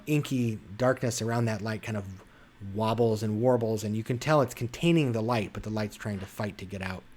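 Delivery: ordinary talk, nothing marked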